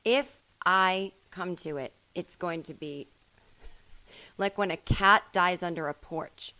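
ordinary talk, nothing marked

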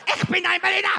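high-pitched German rally parody; mock dictator impression. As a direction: declaim it high like a ranting dictator. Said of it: "that was Mussolini's Hitler impression".

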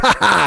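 high, loud "ha ha"; gloating mock laugh. Brothers high-fiving after teasing Alyssa, gloating laugh. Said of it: "Scott and I'd be like".

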